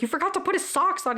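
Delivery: mock-indignant telling-off; retold scolding. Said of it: "she yells at him".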